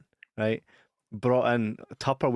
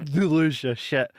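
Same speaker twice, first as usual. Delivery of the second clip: ordinary delivery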